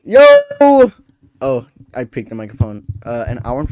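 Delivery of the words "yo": shout. Real, not acted. Yelling into mic.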